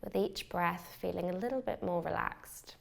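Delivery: calm, unhurried meditation guide with long pauses; measured meditation voice, leaving space for each breath; guided meditation. Acted. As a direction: lead a beginner gently into relaxation, phrase by phrase. Leads a beginner's meditation.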